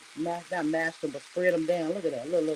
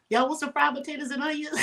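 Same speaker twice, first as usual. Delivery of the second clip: laughing; 8 semitones higher than usual. Real, not acted. Laughing offer to viewers.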